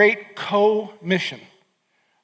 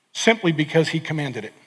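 usual speaking voice

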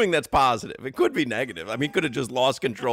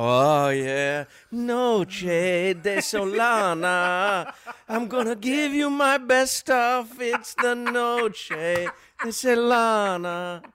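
breathy, slow seductive crooning, higher; sexy voice; 6 semitones higher, slower than usual. Retold or imitated. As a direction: croon it like a bedroom lover. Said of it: "that was his sexy voice".

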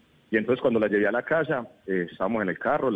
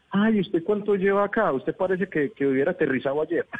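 higher, surprised-asker voice; people in the US; 6 semitones higher than usual. Retold or imitated. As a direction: sound amazed at his accent. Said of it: "siempre me dicen".

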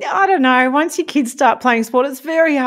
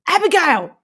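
footy coach calling out; 9 semitones higher than usual. Retold or imitated. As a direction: imitate an exasperated coach calling to a child. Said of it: "you'll hear a coach every now and then say".

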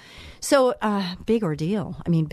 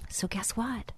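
breathy hushed whisper, much softer; 3 semitones higher, 11 dB quieter than usual. Real, not acted. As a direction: lean in and whisper it like a secret. Hushed aside before God's answer.

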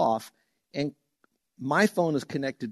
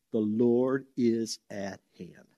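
very slow, weighty statement; solemn slow emphasis on the Lord; slow emphatic delivery; 3 semitones lower, much slower than usual. Shown as a demonstration. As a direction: lay each word down slowly. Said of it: "catch this".

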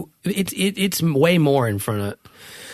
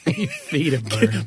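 laughing; 6 semitones higher, much slower than usual. Real, not acted. Repeats through laughter.

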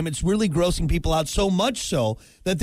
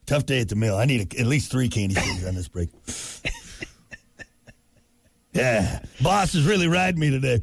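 low, tired mill worker grumble; gruff worker voice; 8 semitones lower than usual. Pretend. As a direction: play a weary factory hand. Mock factory worker kid.

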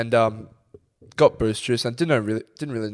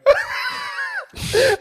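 loud, high burst of laughter; cracking up loud and high; 3 dB louder than usual. Real, not acted. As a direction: break into a big high laugh. Laughs at own story.

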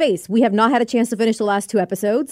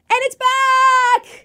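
high, slow, drawn-out cry of joy; thrilled announcement; 4 dB louder, much slower than usual. Real, not acted. Announcing to the hosts that Saga is back, in a high thrilled cry. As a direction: stretch it out like big news.

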